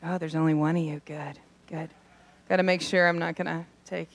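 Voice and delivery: hushed drugged mumble. Retold or imitated. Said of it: "be like".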